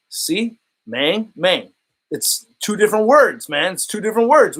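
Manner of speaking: ordinary talk, nothing marked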